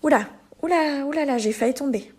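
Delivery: high, quick startled "oula"; nearly tripping, catch yourself; a startled "oula, oula" after almost falling; startled caution. Shown as a demonstration. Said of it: "pour faire attention à quelque chose".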